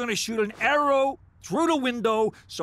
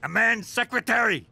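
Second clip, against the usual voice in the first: ordinary delivery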